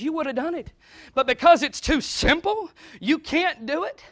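usual speaking voice

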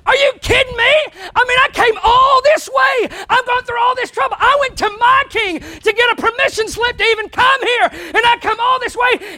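loud, high indignant rant; ticked off Naaman. Acted. Naaman fuming at Elisha for not coming out, loud high rant. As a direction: vent the insult of the long trip. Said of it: "Naaman was ticked off himself about it".

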